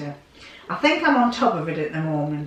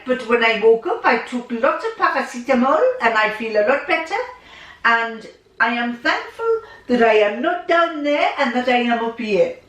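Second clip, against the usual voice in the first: put-on a light French accent, pitched higher, unhurried at the start; 6 semitones higher, 3 dB louder than usual. Elderly father telling his son about aches, lightly French-accented. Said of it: "in his French accent".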